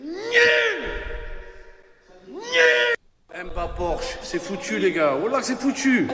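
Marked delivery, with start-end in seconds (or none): 0.0-3.4 shouting. Real, not acted. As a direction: scream it out in disgust. Ranting at a bad player.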